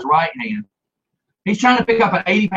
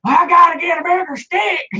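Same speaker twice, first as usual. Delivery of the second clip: high, comic put-on holler; mock panicked holler; 11 semitones higher, 3 dB louder than usual. Dog catcher waddling back around the house, mock-flustered holler. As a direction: cry it out high, imitating a flustered man.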